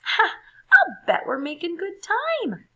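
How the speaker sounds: loud, high, gleeful; thrilled high call; excited. A narrator's reading. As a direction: whoop while swinging. Swinging happily through vines.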